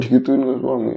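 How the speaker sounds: plain reading voice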